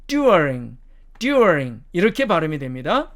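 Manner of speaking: "during" with uh before r; British pronunciation. Shown as a demonstration. Teacher showing the changed vowel, British pronunciation. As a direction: say "during" as "dyoo-uh-ring". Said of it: "어로 변한다 그랬죠".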